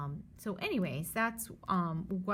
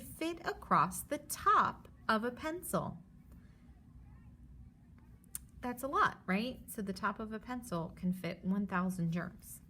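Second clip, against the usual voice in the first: lively storybook read for a class; reading to children; 3 semitones higher than usual. Acted. Teacher reads the germs book aloud to her students. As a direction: read it like a picture book to kids.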